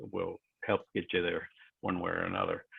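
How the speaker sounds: ordinary talk, nothing marked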